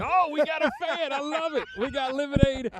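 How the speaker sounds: high, loud and delighted; excited. Real, not acted. Delighted by kid's cheer.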